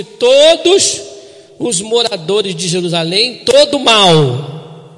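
loud, slow, weighty reading of the verse; preaching scripture. Acted. Pastor reads the sentence to his congregation, loud and slow. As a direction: proclaim every word like a verdict.

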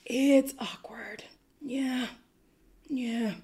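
breathy whispered aside; whispering. Real, not acted. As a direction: whisper it awkwardly.

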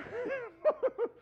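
high squeaky cartoon laugh; Mickey Mouse laugh. God looking down at a fail video, laughing like Mickey Mouse. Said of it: "He looks down and goes".

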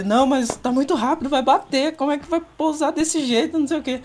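high, rising panicked whine; mock frantic father, pitched well up; panicking dad. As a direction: imitate a terrified father fretting the plane will crash. Dad panicking, plane will crash.

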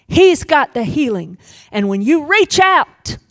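loud, high preaching voice; preaching at full force. Acted. Preacher proclaiming the prophecy to the congregation, loud and high. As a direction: preach it out, building.

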